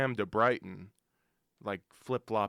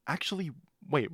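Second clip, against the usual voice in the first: ordinary talk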